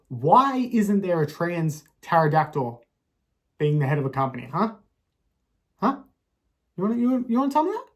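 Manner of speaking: ranting character pushing his point to a loud, high peak; mock outrage building. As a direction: work himself into a hammed-up fury.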